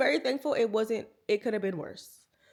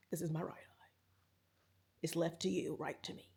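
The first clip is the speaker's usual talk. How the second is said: much softer unvoiced whisper; 6 semitones lower, 14 dB quieter than usual. Real, not acted. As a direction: whisper it as an aside. Whispered aside about eye.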